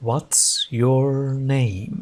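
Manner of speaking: very slow, drawn-out words; slow repeat-after-me. Shown as a demonstration. Teacher asking learners to repeat along, very slowly. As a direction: stretch each word so learners can follow. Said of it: "أعد معي".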